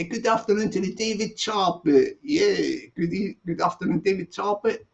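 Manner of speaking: ordinary delivery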